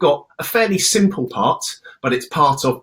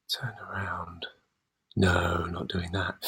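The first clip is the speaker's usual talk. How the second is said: whisper; 8 semitones lower, 11 dB quieter, slower than usual. Real, not acted.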